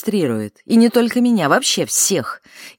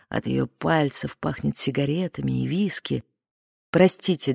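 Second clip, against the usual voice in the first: ordinary delivery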